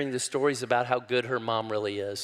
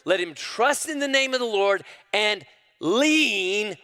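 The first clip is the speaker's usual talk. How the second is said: fast, loud, much higher pleading cry; prophet calling out; 9 semitones higher, 4 dB louder than usual. Acted. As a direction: urge them at full force to trust. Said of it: "Here's what he says".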